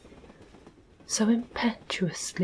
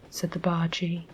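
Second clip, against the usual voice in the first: ordinary delivery, nothing marked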